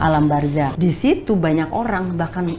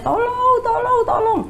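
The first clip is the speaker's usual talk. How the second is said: high yelled tolong, slowing; frightened cries for help, retold; retold yelling for help. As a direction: call out for help, much higher. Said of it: "saya teriak-teriak".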